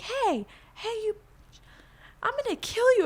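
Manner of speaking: high, mock-threatening woman's voice; angry woman. Driver yelling a threat from the car, high mock menace. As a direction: play the furious driver threatening her. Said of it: "she's like".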